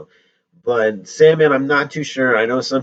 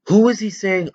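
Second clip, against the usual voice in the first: normal talking voice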